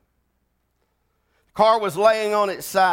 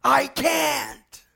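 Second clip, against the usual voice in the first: put-on high, desperate girl crying out I can't; panicked refusal from a trapped child; terrified child's cry, higher pitched; child screaming; 9 semitones higher, much slower than usual. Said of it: "the little girl would scream".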